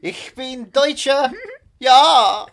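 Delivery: high, slow, jolly German caricature; sing-song comic German accent; hammed-up German accent. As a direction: play a gleeful Oktoberfest German. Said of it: "And you just go".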